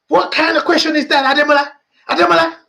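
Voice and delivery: put-on high, indignant Nigerian accent. Friend scolding speaker over the clothes advice, shocked Nigerian accent. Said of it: "I'm doing the accent".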